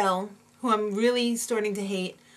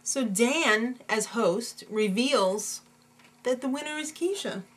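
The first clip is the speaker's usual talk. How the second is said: ordinary talk, nothing marked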